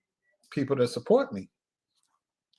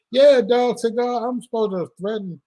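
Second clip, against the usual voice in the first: amused exclaim; 10 semitones higher, 5 dB louder than usual. Real, not acted. Laughing about being called villain.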